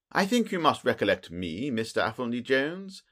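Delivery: ordinary delivery, nothing marked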